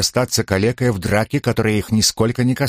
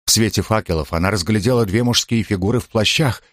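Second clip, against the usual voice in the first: plain reading voice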